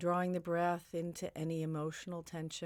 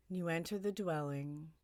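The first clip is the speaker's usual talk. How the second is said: ordinary talk, nothing marked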